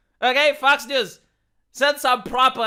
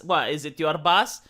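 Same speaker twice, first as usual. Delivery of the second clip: usual speaking voice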